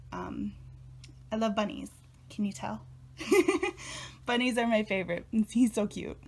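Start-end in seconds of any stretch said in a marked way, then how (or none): 3.2-4.2 high bright giggle. Real, not acted. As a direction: giggle at yourself. Laughs about loving bunnies.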